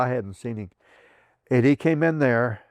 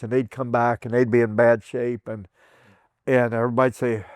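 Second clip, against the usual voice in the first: ordinary talk, nothing marked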